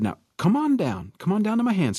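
a hint of a husband luring a kitten; lightly voiced man coaxing a cat.

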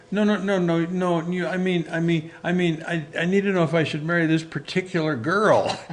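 high, hurried, flustered correction; embarrassed young man correcting a misunderstanding. Retold or imitated. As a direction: scramble to explain yourself.

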